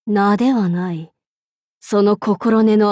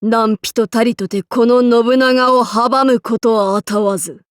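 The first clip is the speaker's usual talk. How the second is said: usual speaking voice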